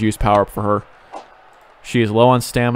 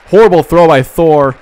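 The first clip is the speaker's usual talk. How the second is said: excited outburst; 3 semitones higher, 8 dB louder than usual. Real, not acted. Reacting to an opponent error.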